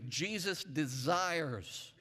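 slow, drawn-out Jesus desires; slow preacher's call; slow call-and-response. Shown as a demonstration. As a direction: stretch each word for the congregation to repeat. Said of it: "let's say it this way".

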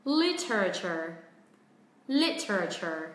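Literature as LIT-er-a-ture, falling from LIT; stressed LIT, four-beat Literature; four syllables. Shown as a demonstration. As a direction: slowly say Literature with the extra "er". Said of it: "Còn cách thứ hai".

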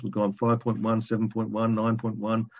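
ordinary talk, nothing marked

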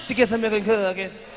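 lightly voiced little boy's answer; imitated six-year-old boy. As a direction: mimic a young boy asking for a treat. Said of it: "That's how he said it".

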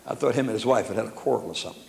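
ordinary talk

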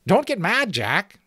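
high-pitched placating voice; soothing sentry. Acted. Sentry calming the angry giant, high soothing voice. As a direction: placate him in a thin high voice. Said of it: "the sentry answered soothingly".

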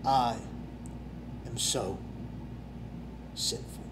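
John the Baptist confessing, barely audible; humble confession. Acted. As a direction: confess it almost in a whisper, humbled. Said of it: "John the Baptist said".

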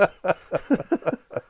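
a real burst of laughter at the memory; hearty laugh. Real, not acted. Pilot laughing at the mad passenger story. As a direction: laugh out loud at the absurd story.

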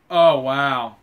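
a loud, stunned oh wow; real shock at the gift, let it burst out; loud amazed gasp. Real, not acted. Overwhelmed by a surprise gift.

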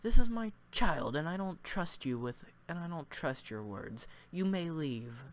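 loud, then cold dismissal; protective spirit. Acted. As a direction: order the stranger out. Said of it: "voice acting for these guys".

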